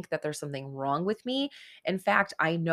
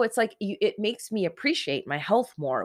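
ordinary talk

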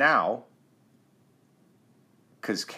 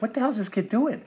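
higher mock-bewildered voice; imitating a listener; 13 semitones higher than usual. Dad voicing a sleepy podcast listener, mock annoyed. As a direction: play a groggy annoyed listener. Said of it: "they're gonna be like".

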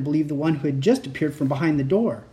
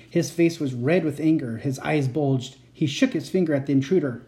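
ordinary delivery

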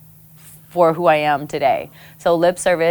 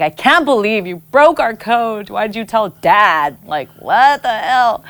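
higher, mock-outraged accusation at mom; lightly hammed hurt accusation, slowing at the end; betrayed teen; 5 semitones higher, 3 dB louder than usual. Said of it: "looking at my mom with distrust".

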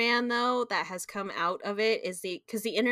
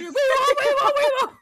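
very high, loud sing-song wee woo; hammed-up siren wail; mock siren; 6 dB louder, faster than usual. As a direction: wail it like a siren getting closer. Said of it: "you just hear".

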